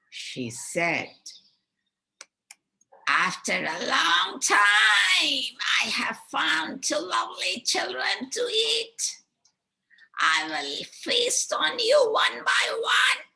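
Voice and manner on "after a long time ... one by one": gloating witch. Acted. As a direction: shriek your hunger like a greedy hag. Witch gloats over her prey.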